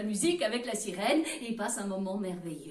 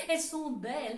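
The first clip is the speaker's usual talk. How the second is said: plain reading voice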